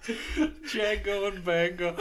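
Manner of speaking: high laughing drawn-out names. Real, not acted. Cohosts mixing up names, cracking up laughing. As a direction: laugh through the words.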